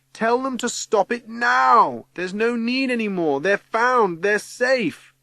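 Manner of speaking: high, loud, stretched command; urgent shout. A narrator's reading. Teggy ordering the saint to end the flood, shouting. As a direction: call out over a roaring storm.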